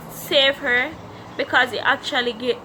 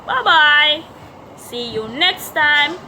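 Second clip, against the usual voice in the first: upbeat outro; 3 semitones higher, 5 dB louder than usual. Real, not acted. Bright vlog outro.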